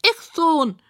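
high, piggy retort; high pig squeal. A narrator's reading. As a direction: squeal back like a proud pig. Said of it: "quiekt Rosamunda".

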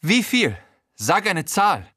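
high, strained wreck of a voice, cocky threat; a battered crook barking orders; Frankies fertige Stimme. Acted. Frankie haggles. (hohe, kaputte Gaunerstimme, frech drohend)

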